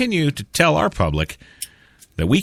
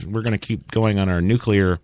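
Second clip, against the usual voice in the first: normal talking voice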